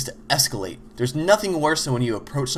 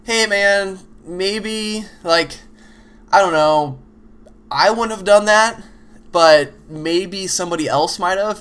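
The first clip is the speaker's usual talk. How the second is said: higher, louder and rambling, dodging the point; rambling hedge; 6 semitones higher, 5 dB louder, slower than usual. Retold or imitated. Telling a Dota teammate his item build was wrong, higher and louder hedging. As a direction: hedge and waffle instead of saying it straight. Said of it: "Don't go".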